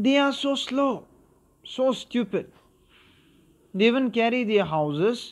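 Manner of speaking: high mocking voice of Makara; mocking bully. Makara mocking the tortoises to the lizards, high sneering voice. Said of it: "trying to make his own foolish comparison".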